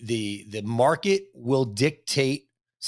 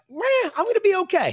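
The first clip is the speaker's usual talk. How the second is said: very high-pitched mock reassurance; mock carefree bravado; 4 dB louder than usual. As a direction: toss it off in a high, put-on voice. Mimics confident reaction to job offers.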